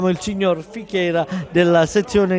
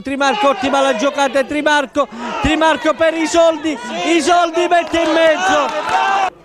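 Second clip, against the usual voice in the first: rising excitement; 6 semitones higher, 4 dB louder than usual. Real, not acted. Attack builds on goal.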